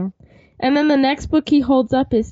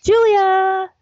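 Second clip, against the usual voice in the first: excited; 6 semitones higher, 4 dB louder than usual. Real, not acted. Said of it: "I am joined by".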